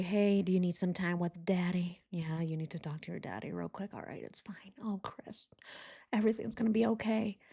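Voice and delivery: hushed, unvoiced whisper of a soothing cop; mock whisper. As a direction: whisper it like calming a child. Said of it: "Be like".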